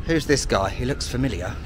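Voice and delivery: normal talking voice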